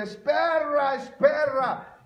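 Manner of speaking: high, mangled gringo "es perra"; gringo accent in Spanish. Shown as a demonstration. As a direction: say "espera" as "es perra" with an American accent. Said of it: "uno no habla bien el español".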